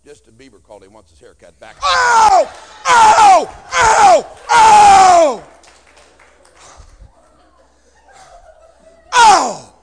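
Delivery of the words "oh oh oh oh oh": very high, loud whooping oh's; mock cries. Comedian whoops after roasting a listener.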